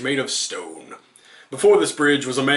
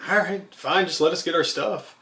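higher-pitched, sighing reluctant agreement; sighed; 6 semitones higher than usual. A narrator's reading. Horse giving in to the farmer, sighed. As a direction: give in with a sigh in your voice. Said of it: "Horse sighed".

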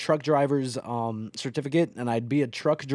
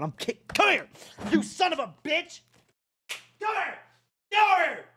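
high, strained yells at the driver; road rage yelling; 11 semitones higher than usual. Real, not acted. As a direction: bark it up high, wanting a fight.

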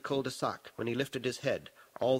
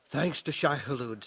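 plain reading voice

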